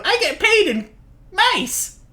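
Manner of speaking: high guard voice. Acted. Guard character boasts pay.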